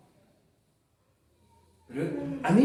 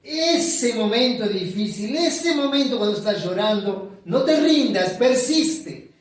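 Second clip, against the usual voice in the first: louder, higher urging call; fervent exhortation; 5 semitones higher, 5 dB louder than usual. Acted. Preacher urging the weeping faithful, loud and fervent. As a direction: push them not to give up.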